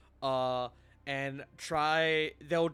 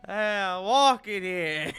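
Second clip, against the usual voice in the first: slow, loud New York drawl; New York accent; 5 semitones higher, 8 dB louder than usual. Retold or imitated.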